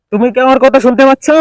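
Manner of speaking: yelling a hearing check to a distant teammate; calling out. Real, not acted. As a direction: call the question out to someone far off. Testing string phone, calling teammate.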